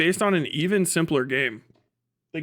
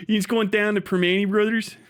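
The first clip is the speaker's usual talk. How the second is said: Philly accent; 6 semitones higher than usual. Shown as a demonstration. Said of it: "This is a Philly people off".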